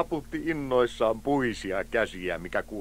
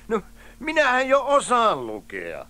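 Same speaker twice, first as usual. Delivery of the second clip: high, loud mocking retort; scoffing; 13 semitones higher, 6 dB louder than usual. Friend turns down the primer, scoffing. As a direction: scoff through amusement, pitch way up.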